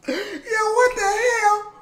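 high-pitched, stunned reaction; shocked disbelief. Real, not acted. Reactor stunned by the rapper's lyrics, shocked disbelief.

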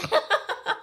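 high unvoiced burst of laughter; breathy laugh. Real, not acted. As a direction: laugh at your own mistake. Laughs at own slip.

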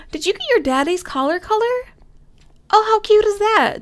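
high, loud cooing delight; gushing. Real, not acted. Gamer gushing over the kitten's collar, high.

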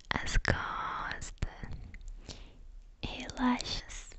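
usual speaking voice

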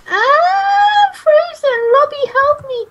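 high, loud mock panic; mock distress. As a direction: cry for help in jest. Character frozen by lag, calling.

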